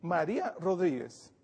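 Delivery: ordinary delivery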